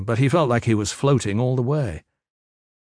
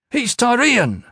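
high, loud accusation; desperate shout; 13 semitones higher, 5 dB louder, slower than usual. A narrator's reading. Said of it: "Aram shouted".

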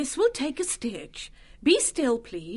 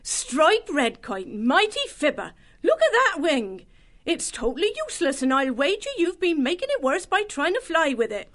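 much higher, scolding mouse voice; admonishing; 3 dB louder than usual. Acted. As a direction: scold the bird like a nurse. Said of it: "wagged an admonishing paw".

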